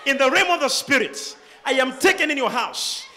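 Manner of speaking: ordinary talk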